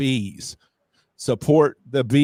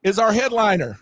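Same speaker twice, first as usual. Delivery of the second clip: hyped announcement; 8 semitones higher than usual. Real, not acted. Hyping the festival venue.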